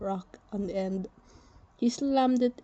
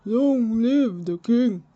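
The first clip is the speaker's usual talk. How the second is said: slow, loud ceremonial cry; proclaiming shout; 7 dB louder, slower than usual. Acted. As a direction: proclaim it again, louder and slower. Repeated proclamation, corrected.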